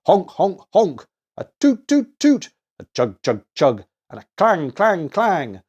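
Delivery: playful loud toy noise imitations. A narrator's reading. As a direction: imitate each toy's honk, toot, chug and clang.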